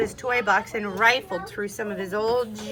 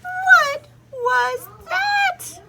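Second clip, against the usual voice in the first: very high, slow, loud startled cry; startled shout; 9 dB louder, much slower than usual. A narrator's reading. Skippy startled by mirror, high startled shout. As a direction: cry out in shock.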